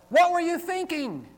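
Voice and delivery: high, loud incredulous outburst; exasperated parent's question; exclamatory question. Shown as a demonstration. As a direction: fire it off in shocked disbelief. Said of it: "and you respond".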